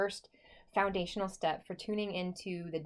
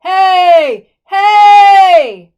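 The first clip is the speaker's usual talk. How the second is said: high, very loud chest-voice shout; 10 semitones higher, 25 dB louder than usual. Shown as a demonstration. Teacher shows students what not to do, shouting. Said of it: "shouting sounds like this".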